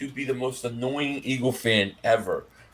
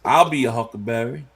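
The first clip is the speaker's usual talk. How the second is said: imitated Southern accent, pitched lower; Doc Holliday; 6 semitones lower, 4 dB louder than usual. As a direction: drop into a lazy-voweled Southern twang. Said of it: "think of Doc Holliday".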